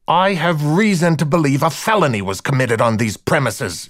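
a policewoman's angry declaration; venomous retort. A narrator's reading. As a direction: answer a yell with venom and authority. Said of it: "matched his volume and raised his venom".

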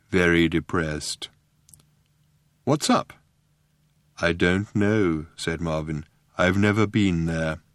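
low, slow depressed robot voice; droning, hopeless robot. A narrator's reading.

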